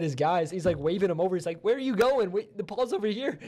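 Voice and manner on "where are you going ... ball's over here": mock frantic calling out, higher pitched; frantic punter. Punter waving teammates back, mock frantic. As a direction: imitate a baffled player calling to teammates. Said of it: "U C L A punter".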